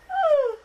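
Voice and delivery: long, loud, high yawn. Real, not acted. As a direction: let out a big sleepy yawn. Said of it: "sorry, excuse my yawn".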